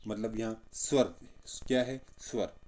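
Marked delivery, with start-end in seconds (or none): none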